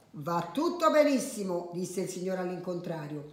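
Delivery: soft storyteller with lively character lines; children's story narration. A narrator's reading. Adult reading a funny tale to children, animated storytelling. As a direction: read it gently, acting out each line.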